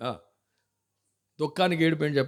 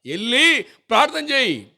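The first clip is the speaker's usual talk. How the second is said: full-force preaching; 8 semitones higher, 6 dB louder than usual. Acted. Preacher urging the congregation to go to God, full-force preaching. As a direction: drive the point home to the church.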